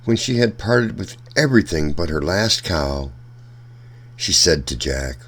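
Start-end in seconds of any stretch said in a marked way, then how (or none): none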